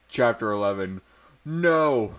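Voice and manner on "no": a higher, mildly louder cry of no; a half-hearted horrified no; mock scream. A narrator's reading. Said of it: "I screamed".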